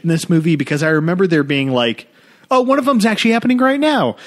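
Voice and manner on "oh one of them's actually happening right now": relieved mock gasp. Relieved moviegoer quote.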